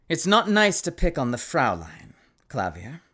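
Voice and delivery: lightly voiced Kristoff, dropping low on the name; bad Kristoff impression. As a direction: put on a light character voice, falling away at the end. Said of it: "I basically do my best bad J. Michael Tatum impression".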